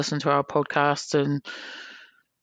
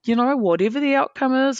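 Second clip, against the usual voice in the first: higher, coaxing reassurance; mock soothing advice; 10 semitones higher than usual. Organiser calming the angry player, higher coaxing reassurance. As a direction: coax him to calm down. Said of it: "and I'm like".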